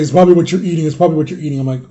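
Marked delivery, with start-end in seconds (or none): 0.0-0.8 louder, nagging mom's first take; a touch of mom insisting, louder; imitating mom. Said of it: "my mom's always like". 0.8-1.6 imitating mom repeating. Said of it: "my mom's always like".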